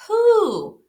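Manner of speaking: squeaky high bunny voice asking who; little rabbit, confused; high-pitched bunny voice; high puzzled Buddy. Acted.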